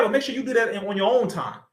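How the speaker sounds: ordinary delivery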